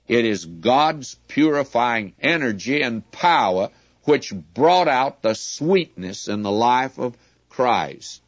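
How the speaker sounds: ordinary talk, nothing marked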